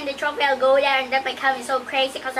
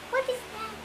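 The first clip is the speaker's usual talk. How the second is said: softer, higher puzzled mumble; softening puzzled murmur; hushed confusion; 4 semitones higher, 14 dB quieter than usual. Real, not acted. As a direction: fade to a puzzled hush. Creepy thing, hushed confusion.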